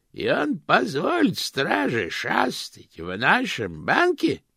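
put-on high, slow, cracked old lady. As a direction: croak like a tiny ancient aunt. Old aunt indignant.

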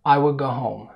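"will" said without its L, at natural speed; L dropped in will. Shown as a demonstration. Teacher repeats the middle speed, showing the L of "will" vanishing. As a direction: run "I will go" together, swallowing the L. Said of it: "ما ظهرش صوت اللام".

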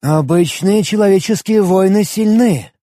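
high voiced bird general. General muses aloud.

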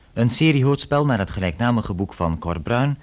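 normal talking voice

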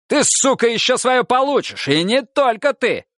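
high threatening snarl; furious threat. Acted. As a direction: threaten him with fury. Sergeant threatening.

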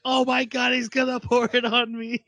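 high-pitched comic panic; hammed-up dread, voice jumping way up; mock freaking out. Host imitates Henri dreading the tea, freaking out. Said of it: "she's, like, freaking out".

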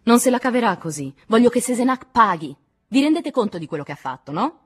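loud, high indignant outburst; shouting. A narrator's reading. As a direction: shout your demand for punishment. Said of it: "Nadine gridò".

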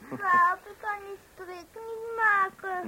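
slow, tearful whine; little one crying to dad; whimpering child. Acted. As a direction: sniffle it out, close to tears. Child can't tie bow, crying heard.

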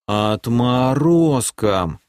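медленно, чеканя каждый слог; выговори слово по слогам. Acted. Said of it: "чуть ли не по слогам выговорил эльф". (медленно, по слогам)

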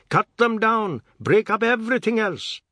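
high, loud furious order; shouting. A narrator's reading. Biswas smashing up his house, shouting. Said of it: "Mister Biswas was shouting".

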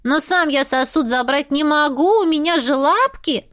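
high-pitched, plaintive cat voice; cat character, protesting. Acted. Cat explaining he only has paws, high protest. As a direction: protest as a helpless talking cat.

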